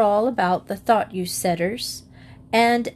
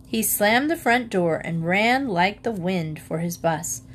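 usual speaking voice

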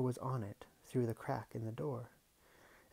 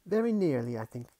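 wrong butler voice; 3 semitones higher, 9 dB louder than usual. Shown as a demonstration. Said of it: "That's not the butler".

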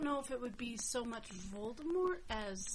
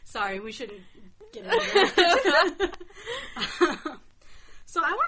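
laughing apology; 8 semitones higher, 14 dB louder than usual. Real, not acted. Laughing at her own joke.

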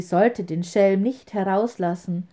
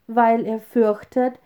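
plain reading voice

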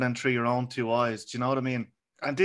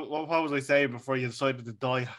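ordinary delivery

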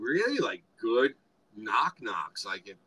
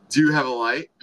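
sexy voice; 7 dB louder than usual. Retold or imitated. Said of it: "again, in a sexy voice".

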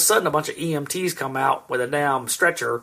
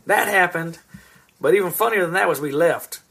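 ordinary talk

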